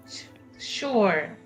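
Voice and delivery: ordinary delivery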